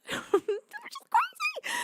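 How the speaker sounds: very high, drawn-out giggly squeak; amazed, laughing squeal. Real, not acted. Streamer amazed at own follower count, laughing high squeal. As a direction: squeal it slowly through a disbelieving laugh.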